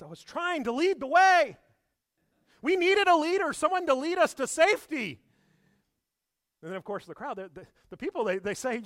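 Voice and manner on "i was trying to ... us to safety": much higher, loud self-justifying voice; George defending himself. Retold or imitated. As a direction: insist you were the hero, indignant. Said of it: "He says".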